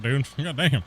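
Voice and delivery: ordinary talk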